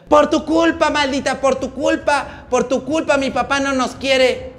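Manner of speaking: loud, high-pitched mock scream; shrieking accusation. Paola comes running at them in the street, shrieking accusation. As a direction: yell the blame in a high, drawn-out wail. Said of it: "que empieza a gritar la ridícula".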